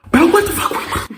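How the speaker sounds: very high, loud drawn-out scream; retold outburst. Walking out to see sister held, screamed outburst. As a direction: screech it in disbelief, stretched out.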